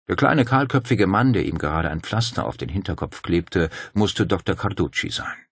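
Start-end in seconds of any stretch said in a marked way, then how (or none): none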